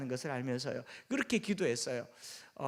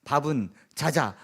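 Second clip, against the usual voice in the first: loud one-word Gyeongsang utterances; terse Gyeongsang man; 7 dB louder, slower than usual. Acted. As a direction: bluntly toss out each word in Gyeongsang dialect. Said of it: "남자들끼리 하는 말". (무뚝뚝한 경상도 남자처럼 짧고 크게)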